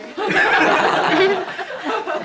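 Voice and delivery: high, loud cackling laugh; real laughter. Real, not acted. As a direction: burst out laughing loudly. Laughs at own sad story.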